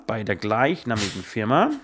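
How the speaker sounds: slow, low, formal dictation; official dictation voice. Shown as a demonstration. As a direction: read it out like an official document. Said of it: "das war jetzt sehr offiziell".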